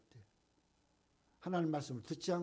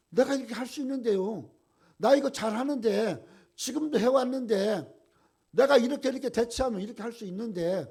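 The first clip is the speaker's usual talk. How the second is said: high, loud, bragging insistence; proud believer shouting he can do it alone; boastful self-reliant believer; 6 semitones higher, 9 dB louder than usual. Retold or imitated. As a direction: brag that you can handle it yourself.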